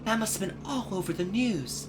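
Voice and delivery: put-on lifted, boyish sidekick voice; curious boy apprentice asking his mentor; young Luke voice. Luke voice again.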